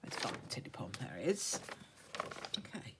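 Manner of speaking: usual speaking voice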